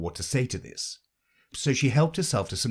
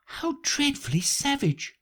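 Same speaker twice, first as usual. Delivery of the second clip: exclaiming. Acted. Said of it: "exclaimed Alice".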